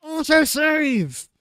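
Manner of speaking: slow, furious cry at an untimely autosave; frustrated shout. Real, not acted. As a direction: bellow it slowly at the game in despair. Game autosaves mid-recording.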